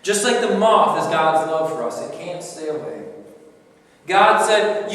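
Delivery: usual speaking voice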